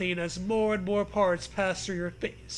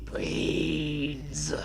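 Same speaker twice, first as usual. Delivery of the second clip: zombie moan; 4 semitones lower, 4 dB quieter than usual. Acted.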